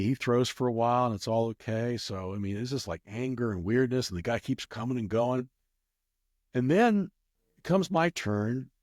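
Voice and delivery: usual speaking voice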